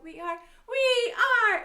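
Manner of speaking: very loud, high chanted shout; big rallying sing-song shout. Shown as a demonstration. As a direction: yell it out with the kids.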